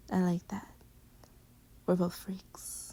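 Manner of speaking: tender whisper. Real, not acted.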